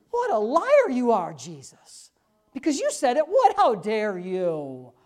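hammed-up indignation, slowing on the last words; mock outrage. Preacher voices the doubter calling Jesus a liar, mock outrage.

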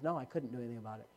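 quick, low, soft correction; calm retold answer. Jesus correcting Hagin in the retelling, quietly firm. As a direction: toss it back low and fast. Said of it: "He says".

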